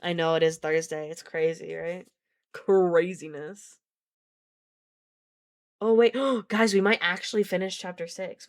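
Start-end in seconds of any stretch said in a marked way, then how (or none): 2.5-3.8 slow stretched word; drawn-out. Real, not acted. 5.8-8.5 excited realization. Real, not acted. Realizing with a gasp.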